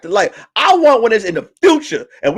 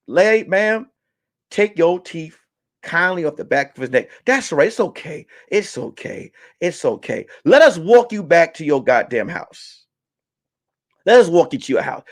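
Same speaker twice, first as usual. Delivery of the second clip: soothing mock cop; 3 semitones lower, 4 dB quieter than usual. Police gently coaxing biting woman.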